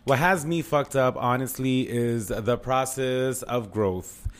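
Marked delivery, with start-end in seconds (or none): none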